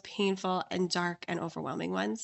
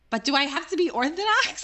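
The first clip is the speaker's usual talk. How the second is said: high, louder comic protest; mock whine; 7 semitones higher, 4 dB louder than usual. Seeker who found what she wants, whiny reluctant protest. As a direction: plead it with exaggerated reluctance.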